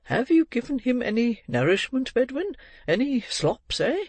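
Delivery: put-on high, swooping old gentleman's questions; brisk old gentleman. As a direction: quiz the housekeeper, voice leaping upward. Old gentleman questions his housekeeper.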